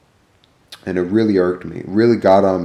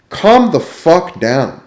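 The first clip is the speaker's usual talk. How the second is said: exasperated; 7 semitones higher, much slower than usual. Real, not acted. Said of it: "vents at the rude cook".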